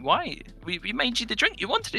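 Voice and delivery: incredulous. Retold or imitated. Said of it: "And I was like".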